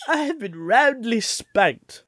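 slow, high, mock-posh roundly spanked; hammed-up posh delivery. Shown as a demonstration. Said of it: "How would Sean Connery say it?".

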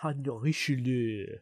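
hammed-up French pronunciation; French accent. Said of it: "our terrible accents this week".

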